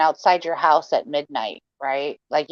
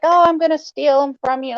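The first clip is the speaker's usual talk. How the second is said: high playful tease; lightly mock threat, joking; teasing; 9 semitones higher than usual. Just joking around.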